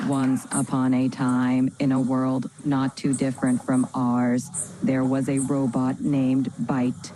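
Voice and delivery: low, even, robotic storyteller; dramatic robotic voice. Acted. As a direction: read it like a dramatic machine. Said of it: "Initiating dramatic robotic voice".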